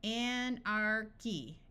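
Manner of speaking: "Anarchy" drawn out slowly, each syllable clear: an-ar-chy; teacher stretching "Anarchy" syllable by syllable; slow demo. Shown as a demonstration. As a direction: sound out "Anarchy" slowly for a student, hard K. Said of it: "some more words where C H sounds like the letter K".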